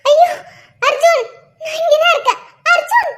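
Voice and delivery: high tearful shout; calling out in pain. Acted. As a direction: yell for her husband to hear her. Calling husband from distance. (கணவனை அழுதபடி கூவி அழைத்தல்)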